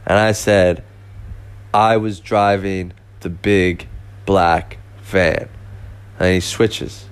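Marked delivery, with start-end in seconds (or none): 1.7-5.5 repeating the answer slowly to a friend on the phone; slow deliberate repeat. Retold or imitated.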